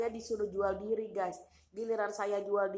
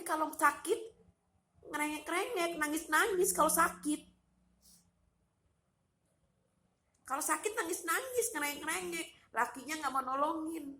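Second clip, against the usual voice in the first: high-pitched mocking whine; mock whining; 7 semitones higher than usual. Mocking a relative who cries when sick, high mock whine. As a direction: raise the pitch, imitating a crybaby.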